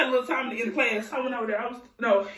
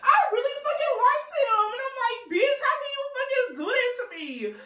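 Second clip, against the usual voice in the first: higher, laughing through tears; half laughing, half crying over the friend; choked-up laugh-cry, higher; tearing up; 10 semitones higher than usual. Real, not acted. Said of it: "I'm tearing up".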